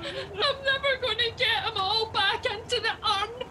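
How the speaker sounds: high, tearful whine; crying. Acted. Passenger crying at driver over spilt ashes, tearful. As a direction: sob out the blame in despair. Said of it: "Stop crying".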